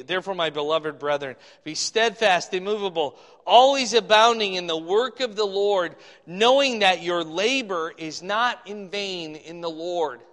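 emphatic, loud Bible recitation; scripture reading. Acted. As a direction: drive the verse home phrase by phrase. Reads scripture aloud.

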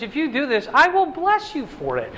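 a hint of God's own voice making a promise; God speaking. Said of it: "God says".

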